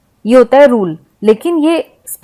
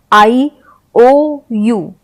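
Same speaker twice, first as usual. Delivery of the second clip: normal talking voice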